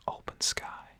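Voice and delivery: plain reading voice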